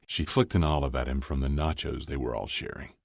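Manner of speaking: ordinary delivery, nothing marked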